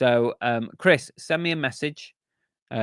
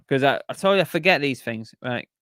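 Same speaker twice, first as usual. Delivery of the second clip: ordinary talk, nothing marked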